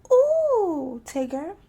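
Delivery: high, loud delighted squeal. A narrator's reading. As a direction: squeal with joy. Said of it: "Roo squealed again".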